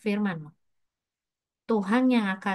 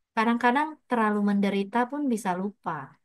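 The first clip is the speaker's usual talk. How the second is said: usual speaking voice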